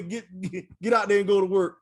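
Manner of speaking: retold bark. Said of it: "and told me".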